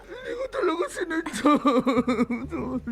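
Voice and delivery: wailing, tearful excuse; crying. Real, not acted. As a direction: break down sobbing as you apologise. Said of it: "Babe, umiiyak ka pa".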